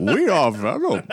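slow, drawn-out villain impression; theatrical mock villain; Bane. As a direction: stretch it into a hammed-up masked-villain declaration. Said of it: "but Venom is Bane".

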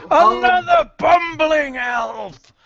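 slow, high scornful shout; banshee shout. Acted. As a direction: sneer it out loud and slow.

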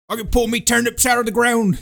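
high, hammed-up Cockney yokel; mock Cockney farmer. Mocking Statham's Cockney farmer in the film, hammed-up Cockney. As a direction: put on a silly Cockney farmer voice. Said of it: "'cause he's a Cockney".

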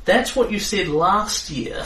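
put-on young centaur girl, high and slow; proud voice with horse snorts. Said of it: "she says".

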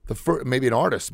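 normal talking voice

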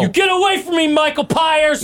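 high-pitched shout of comic panic; mock alarm. Recoiling from Michael Pyers and his pies, mock-panicked. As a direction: shriek it like a pie-throwing victim. Acting out pie victim's protest.

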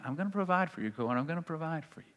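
soft, soothing voice of God; gentle reassurance. Acted. God reassuring the panicked preacher, gentle reassurance. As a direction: hush it right down to calm the worrier.

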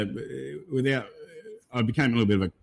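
ordinary talk

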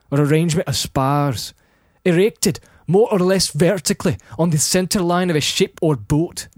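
emphatic Scottish-accented reading; Scottish accent narration. Shown as a demonstration. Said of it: "try to narrate in a Scottish accent".

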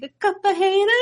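high-pitched wrestler catchphrase; Enzo. Retold or imitated. Woman in meetings echoing a wrestler, imitated voice. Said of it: "I'm just in his voice".

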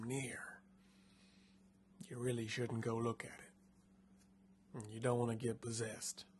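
hushed dread, as if the spirits could hear; a touch of a frightened guide's hushed warning. Acted. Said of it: "like, cracking as he speaks because he's so horrified".

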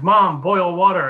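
calling up the stairs, moderately loud and drawn out; mock shout for mom's help; retold shout. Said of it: "So I shout up the stairs".

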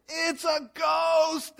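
high, drawn-out cry of alarm; terrified cry. Acted. Disciples seeing Jesus on the lake, terrified cry. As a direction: stretch it out high, like spotting a ghost. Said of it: "they cried".

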